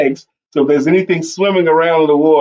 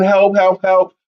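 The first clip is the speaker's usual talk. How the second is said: raised-pitch mock calls for help; retold yelling; 6 semitones higher, slower than usual. Soldier still calling with nobody answering, retold yelling. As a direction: lightly imitate repeated yells, one after another. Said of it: "I'm yelling".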